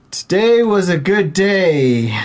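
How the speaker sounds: hammed-up declaiming of a simple line; poem recital. Bard performs his poem for the party guests, declaiming.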